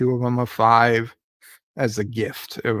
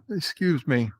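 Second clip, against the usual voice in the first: ordinary delivery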